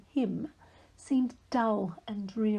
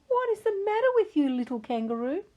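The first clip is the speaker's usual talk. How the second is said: concerned kangaroo; 9 semitones higher, faster than usual. Acted. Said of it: "sighed Kiki Kangaroo".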